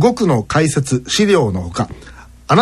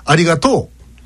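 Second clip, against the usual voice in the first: exaggerated Osaka intonation on "ありがとう", rising late; demonstrated Osaka-dialect "ありがとう"; Osaka accent; 3 semitones higher than usual. Shown as a demonstration. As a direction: say "ありがとう" with overdone Osaka pitch. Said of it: "これが大阪弁だと".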